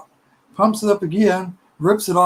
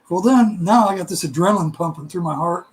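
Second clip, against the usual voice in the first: ordinary talk, nothing marked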